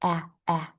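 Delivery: "ah" as the open vowel in apple; short A sound. Shown as a demonstration. Teacher demonstrating vibration in the A sound, short A sound. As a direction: say "ah" twice, short and separate, mouth spread. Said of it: "your tongue will curl up around the ends".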